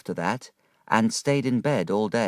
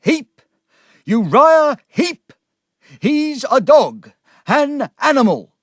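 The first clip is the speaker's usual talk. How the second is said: slow, high-pitched, full-volume outburst; loud, drawn-out cry of loathing; furious shout; 11 dB louder, slower than usual. Acted. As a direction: shout the hated name in outrage. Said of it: "Then he shouted".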